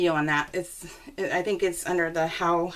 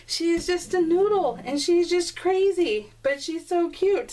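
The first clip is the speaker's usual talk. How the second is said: higher, slower, louder gushing; 10 semitones higher, 3 dB louder than usual. Real, not acted. Dog lover gushing over her noodle bulldog, excited. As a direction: coo over the silly cute dog.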